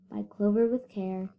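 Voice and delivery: usual speaking voice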